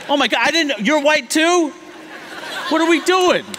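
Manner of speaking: loud, high-pitched shocked realization; mock astonishment. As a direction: act floored by a sudden discovery. Acted Europeans suddenly realizing shared whiteness.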